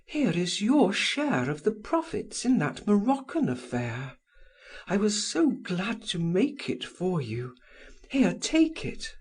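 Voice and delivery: high woman's voice, pressing a gift; adoring woman offering money, high and eager; eager lover. A narrator's reading. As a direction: urge the money on him. Held Madame Walter voice.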